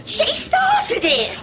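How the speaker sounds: high, drawn-out wail of blame; outraged accusation. Acted. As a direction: protest shrilly like a told-off child. Blames rival after fight broken up.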